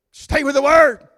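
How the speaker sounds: put-on preacher shouting his charge to the young; loud preaching. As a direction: stay with the word shouted high. Preaching climax.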